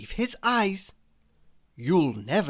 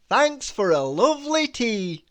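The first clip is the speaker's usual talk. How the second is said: higher jolly character voice; raised-pitch, jolly farewell; cheery thanks; 5 semitones higher, 3 dB louder than usual. A narrator's reading. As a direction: call out happy thanks. Mister Happy thanks his host.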